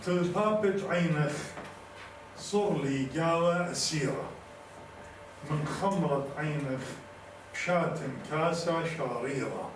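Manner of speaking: measured poetic delivery with drawn-out words; poem recitation. Acted. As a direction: declaim each line slowly, pausing between phrases.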